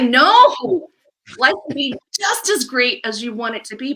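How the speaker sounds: loud, high cry of refusal, then rousing; emphatic objection. Acted. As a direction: burst out to overturn their doubts. Answers the imagined client's doubts emphatically.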